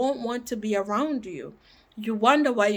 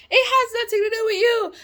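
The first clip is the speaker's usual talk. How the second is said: emphatic burst; 12 semitones higher, 5 dB louder, faster than usual. Real, not acted. Insistent reassurance to viewers.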